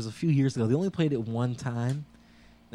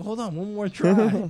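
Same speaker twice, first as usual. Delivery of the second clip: much higher, slow lightly voiced dictator; calm mock reassurance; 7 semitones higher, 5 dB louder than usual. Dictator trying again, high slow mock voice. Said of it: "he's like".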